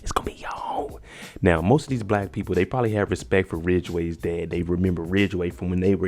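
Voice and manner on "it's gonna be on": menacing whisper. Retold or imitated. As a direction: breathe it out as a quiet threat. Armed man taunting Ridgway.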